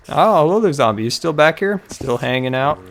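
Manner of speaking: loud high hello to a zombie; surprised greeting. Real, not acted. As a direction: greet it with mock delight.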